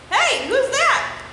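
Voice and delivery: high, loud, slow crayon voice; excited crayon voice. Acted. Crayon jumping out of the box, excited high call. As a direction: pop out of the box calling.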